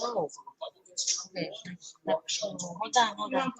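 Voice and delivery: faint voice through a headset; quiet and far away on a mic; bluetooth headset mic. Real, not acted. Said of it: "You're on Bluetooth".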